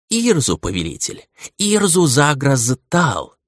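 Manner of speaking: eager, slow creature announcing its name. Acted. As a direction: answer proudly and readily. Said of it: "с готовностью отозвался тот".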